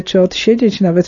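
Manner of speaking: ordinary delivery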